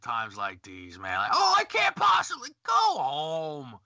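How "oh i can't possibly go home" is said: mocking whine. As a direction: sneer at the entitled ones. Mocking entitled people.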